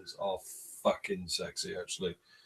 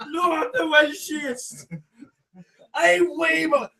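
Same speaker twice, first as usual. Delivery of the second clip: put-on high, loud, drawn-out Welsh accent; mock Welsh; 6 dB louder, slower than usual. Topping a friend in a Welsh off, mock Welsh shout. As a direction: outdo the others in hammed-up Welsh. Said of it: "A Welsh off".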